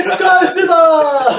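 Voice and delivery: high, loud, slow laughing scream. Pretend. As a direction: shriek it through laughter. Echoing the Godzilla panic joke.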